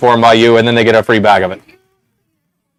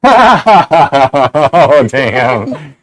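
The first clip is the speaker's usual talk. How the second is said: laughing; 3 semitones higher than usual. Real, not acted. Laughing at what he sees.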